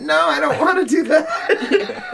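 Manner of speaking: squealing high no no no; high-pitched girly refusal with a laugh; mock high protest. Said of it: "every girl is like".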